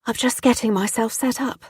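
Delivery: put-on posh accent, putting on innocence; posh, plummy accent. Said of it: "my accent posh and plummy".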